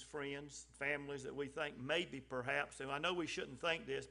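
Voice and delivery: normal talking voice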